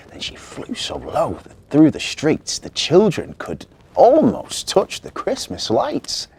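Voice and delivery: usual speaking voice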